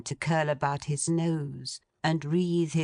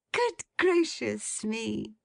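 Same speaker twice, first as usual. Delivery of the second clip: higher, slow astonished exclamation; shocked cry; 7 semitones higher, slower than usual. Acted. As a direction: gasp it out in mock horror. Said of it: "cried Meg".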